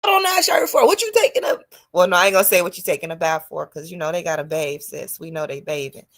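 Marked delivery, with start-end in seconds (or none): 0.0-3.7 put-on suspicious girlfriend. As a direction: demand answers like a jealous girlfriend. Grilling a man about grooming.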